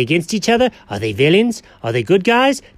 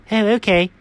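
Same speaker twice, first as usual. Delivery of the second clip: usual speaking voice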